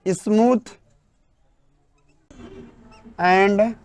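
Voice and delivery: very slow, separated words for note-taking; word-by-word dictation. Acted. Teacher dictating the English definition, very slow and spaced. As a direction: dictate each word with long gaps.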